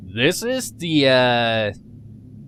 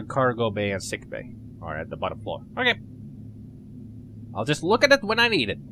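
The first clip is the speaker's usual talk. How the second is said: ordinary delivery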